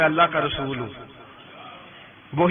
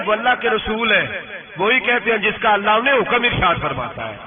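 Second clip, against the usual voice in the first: normal talking voice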